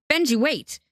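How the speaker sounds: high, urgent cry to wait; alarmed plea. Acted. Theo to Benji as the knife comes to his throat, alarmed plea.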